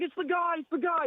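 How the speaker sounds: a frantic high squeal of recognition; tripping friend spotting John Bobbitt, high panicked mimic; mock freak-out. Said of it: "he keeps freaking out".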